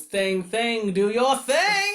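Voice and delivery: rising chant, drawn out at the end; hammed-up rallying cry; comic catchphrase call. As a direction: build to a slow, high hero's battle cry. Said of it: "Ben, he goes".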